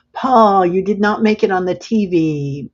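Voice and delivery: loud, high-pitched exclamation to a friend; playful loud outburst; calling out. Real, not acted. Teasing call to a chat member.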